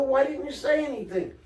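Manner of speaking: husband stunned to learn wife is unhappy, surprised; retold surprise. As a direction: ask it like the news just hit you, replayed.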